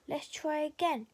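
usual speaking voice

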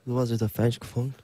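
very slow, blurred mumbled English; fake fluent English. Shown as a demonstration. Guest pretending to speak English to foreigners, slurred and casual. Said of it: "영어를 잘하는 척하는 거예요".

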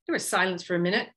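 ordinary delivery

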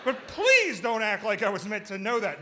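high, loud, exasperated retort; comic snaps back at his roommate, high and loud; snapping back. Retold or imitated. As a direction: finally lose patience and snap. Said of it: "that's when I snapped".

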